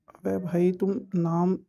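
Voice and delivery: ordinary talk, nothing marked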